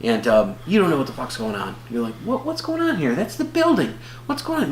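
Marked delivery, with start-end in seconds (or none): 2.2-4.7 high-pitched puzzled viewer; mock bewilderment. Viewer wondering what is going on, mock bewildered. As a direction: act baffled, voice jumping way up.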